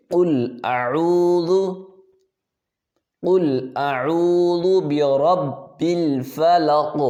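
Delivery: slow melodic tajweed chanting; Quran recitation. Shown as a demonstration. Teacher reciting a verse for students to repeat, melodic. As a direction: recite it slowly in a chanting tune.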